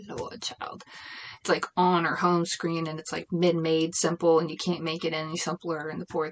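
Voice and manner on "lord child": whispered weary sigh and mutter. Real, not acted. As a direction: breathe it out, fully exasperated. Exasperated aside about grandma.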